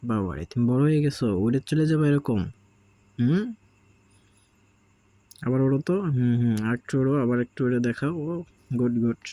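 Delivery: cooing at a sulky parrot chick; coaxing pet talk. Real, not acted. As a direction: sweet-talk the sulking bird onto your hand. Praising bird flying.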